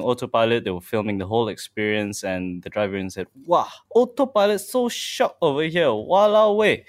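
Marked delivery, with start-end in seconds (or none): 3.5-6.8 put-on higher-pitched Singaporean accent with a Singlish exclamation. Host imitates Singaporean driver filming his Tesla, Singaporean accent. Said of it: "you like my Singaporean ex-, uh, accent?".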